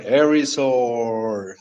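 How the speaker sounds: slow, low, drawn-out inner voice; a lightly voiced mystical whisper-like calling of Erisor; voice heard in my head. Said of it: "with a tone like that".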